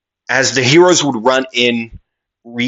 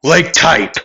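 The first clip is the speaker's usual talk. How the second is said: high, raised move announcement; attack call; 6 semitones higher, 3 dB louder than usual. Acted. Fighter naming the next attack mid-fight, voice raised. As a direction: call out each move with force.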